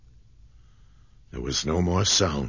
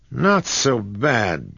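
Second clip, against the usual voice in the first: slow, louder, higher eager exclaim; enthusiastic cry; 6 semitones higher, 5 dB louder, slower than usual. A narrator's reading. John sees the stones, enthusiastic cry. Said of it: "cried John enthusiastically".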